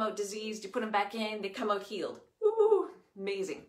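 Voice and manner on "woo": high, loud whoop of delight; excited woo. Acted. Showing the class God's amazing trick, a loud whoop. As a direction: cheer it like a kid at a magic show.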